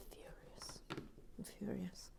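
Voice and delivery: whispered mock fury; furious but whispered. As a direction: breathe it out like secret outrage. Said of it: "reading comment in whisper".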